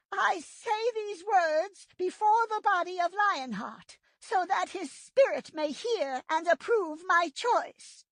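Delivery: high, slow, solemn she-cat leader. A narrator's reading. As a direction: speak slowly before the fallen. Leader speaks solemnly over the body.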